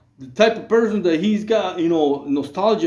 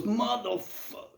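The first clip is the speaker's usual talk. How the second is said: mock pained groan; 4 semitones higher, 11 dB quieter than usual. Coworker groaning after a punch at work, quiet pained curse. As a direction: groan it out like you just took a hard punch. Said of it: "and he goes".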